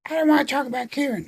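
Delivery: put-on very high, fast, whiny girl voice; a hammed-up sulky teen dodging; whiny mock Emma. Mocking Emma refusing to talk about Kieran. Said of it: "And Emma's like".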